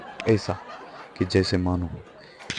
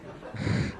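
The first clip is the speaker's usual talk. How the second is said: soft breathy giggle; 6 dB quieter than usual. Real, not acted. Breaks into laughter mid-story.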